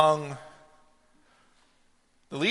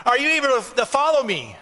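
higher and louder preacher's challenge, slowing at the end; preaching at full force; 9 semitones higher, 4 dB louder than usual. Acted. Preacher challenging the congregation to follow Jesus, full force. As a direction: press the question on the congregation.

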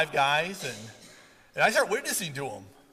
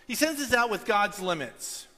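ordinary talk, nothing marked

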